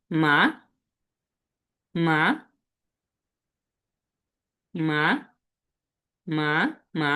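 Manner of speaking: each "মা" sliding up at the end; rising second tone. Shown as a demonstration. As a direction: raise the pitch through every "মা". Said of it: "শব্দটা হবে এরকম". ("মা" উঠতি সুরে, রাইজিং টোনে)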